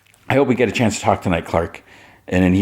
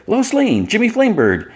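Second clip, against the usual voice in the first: much higher, sing-song names; high greeting. Retold or imitated. Kara greets Lois and Jimmy, high sing-song greeting. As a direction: greet them brightly, way up in pitch. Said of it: "she's like".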